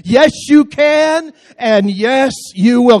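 high, loud, slowed preaching shout; rousing shout. Real, not acted. Preacher shouting the refrain to fire up the church. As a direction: belt the refrain out to lift the room.

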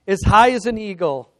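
loud, proud recited reply; retold emphatic response; rallying answer. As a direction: sound off the motto. Daughter's drilled answer to father.

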